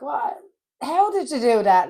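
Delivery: high, loud mock disbelief; retold astonishment. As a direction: blurt it out as an amazed retelling. Retold reaction to dad's fall.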